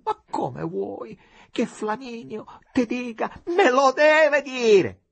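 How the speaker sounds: frantic. A narrator's reading. Frantic woman refuses Capolino's excuses, shrieking. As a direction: near hysteria, hammering each word.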